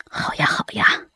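quiet unvoiced eager yes; hushed eager whisper. A narrator's reading. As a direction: breathe it out eagerly. Son agrees eagerly, hushed.

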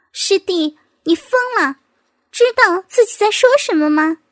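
high, indignant woman's voice; rattled, incredulous questioning; shocked rebuke. A narrator's reading.

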